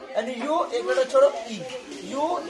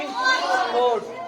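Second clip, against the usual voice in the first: usual speaking voice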